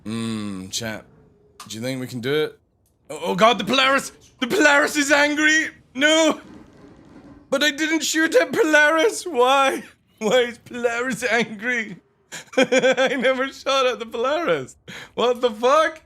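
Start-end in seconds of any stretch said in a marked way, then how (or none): 3.1-9.8 high, loud alarmed outcry; panicked shout. Real, not acted. Ship turns hostile, panic. 10.2-16.0 high, laughing protest; laughing disbelief. Real, not acted.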